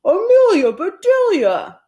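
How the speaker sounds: high, loud put-on shout; exasperated yell. Mister Rogers yelling at Amelia Bedelia, loud and dismayed. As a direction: yell her name in dismay. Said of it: "yelled Mister Rogers".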